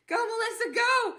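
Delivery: loud, high cheer. Real, not acted. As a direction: whoop it like egging someone on. Cheering herself on.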